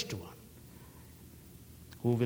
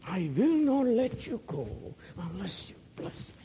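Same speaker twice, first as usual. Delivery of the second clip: pleading prayer; 6 semitones higher than usual. Pretend. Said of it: "crying out to God".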